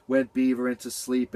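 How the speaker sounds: usual speaking voice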